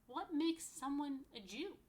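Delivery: slow, lilting storybook question; slow storybook read-aloud; reading to children. Acted. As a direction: ask it like a picture-book page to little ones. Reads book question aloud.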